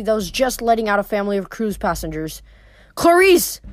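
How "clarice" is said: high, raised call of a name; narrated yell, pitched up. A narrator's reading. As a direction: call out urgently to get attention. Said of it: "I yelled".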